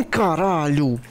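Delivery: ordinary talk, nothing marked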